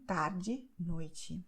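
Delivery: tarde and noite ending in a clear i; final e as i. Shown as a demonstration. Teacher showing that final e sounds like i. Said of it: "will be pronounced as I".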